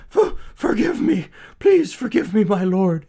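high, loud, stammering plea; terrified man pleading in a high voice; begging. A narrator's reading. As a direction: beg for your life, weak with fear. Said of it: "he begged".

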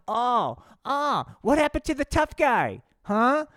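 high, loud taunting oracle; mocking Seer Stone. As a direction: taunt the fallen tough guy. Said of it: "the Seerstone said".

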